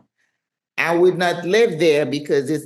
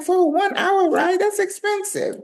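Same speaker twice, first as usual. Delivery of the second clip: shocked high voice. Retold or imitated. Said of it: "And I'm like".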